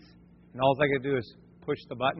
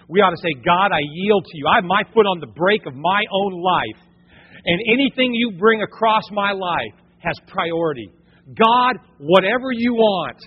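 loud, rising preacher's plea; impassioned sermon prayer; fervent preaching; 4 semitones higher, 7 dB louder than usual. Acted. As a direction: pour it out like a pulpit prayer. Preaching at full force.

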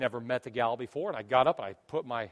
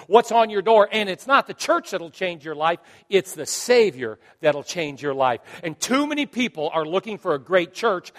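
loud, raised, emphatic sermon voice; impassioned preaching; 4 semitones higher, 10 dB louder than usual. Real, not acted. Pastor urging the Savior over church, forceful preaching. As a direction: drive each contrast home forcefully.

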